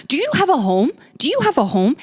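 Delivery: high, mocking disbelief; incredulous. Scolding the roommate about her guests, incredulous and high. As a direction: sneer the question up high.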